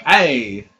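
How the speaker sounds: usual speaking voice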